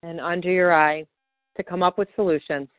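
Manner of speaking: calm, steady guided affirmation read; guided tapping script. Acted. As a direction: lead the listener gently through each tapping point.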